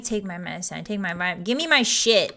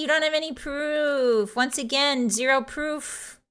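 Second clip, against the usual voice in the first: put-on slow, drawn-out Valley Girl vowels; 3 semitones higher, 3 dB quieter, slower than usual. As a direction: deny it like a sassy Valley Girl. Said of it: "Valley Girl accent".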